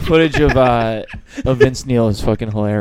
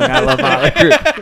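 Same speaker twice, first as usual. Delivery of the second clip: laughing mock fan; 13 semitones higher, 5 dB louder than usual.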